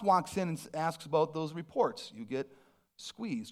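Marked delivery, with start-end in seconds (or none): 2.1-3.5 quiet. Acted. Repeated sermon refrain.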